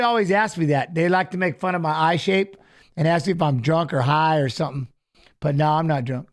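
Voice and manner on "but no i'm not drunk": ordinary talk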